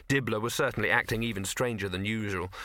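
usual speaking voice

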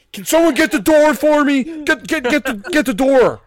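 high, loud panicked shout. Pretend. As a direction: call out frantically for help. Robber asking for door, loud.

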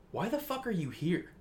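high, soft imitation of disbelief; incredulous thought. Dick finds Slade waiting inside, softly incredulous. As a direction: pitch it up quietly, baffled. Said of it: "he's just thinking, like".

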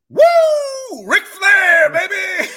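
very high, very loud shouted whoop; wrestler whoop. Retold or imitated. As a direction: scream it like a pumped-up wrestling fan. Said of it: "he goes".

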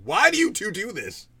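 high, loud, drawn-out mock exasperation; annoyed adult daughter. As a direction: complain slowly at embarrassing parents. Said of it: "just to annoy an adult Sonara".

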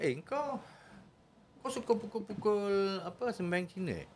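lightly voiced knowing healer. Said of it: "Dia cakap".

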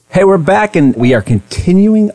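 bright loud welcome back; upbeat host return. Acted. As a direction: burst back on air. Energetic return from break.

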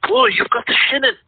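high, put-on Scottish groundskeeper.

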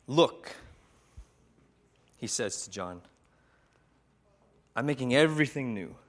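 measured, reverent storytelling with long pauses; sermon story narration. A narrator's reading. Preacher retelling John's vision to listeners, reverent.

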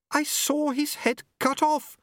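high, slow, shaken babble; babbling. Acted. Unity babbling to Susan after seeing a head cut off. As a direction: pour it out in shock. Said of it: "Unity babbled".

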